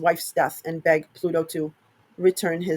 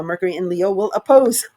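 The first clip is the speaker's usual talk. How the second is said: ordinary talk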